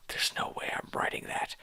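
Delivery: breathy unvoiced aside; whispered aside. Real, not acted. Reader whispers a snarky aside to listeners. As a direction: whisper it as a private joke.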